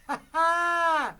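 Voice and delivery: high, very loud jeering; Grandma jeers at the family, high and loud; mocking accusation. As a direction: rub it in.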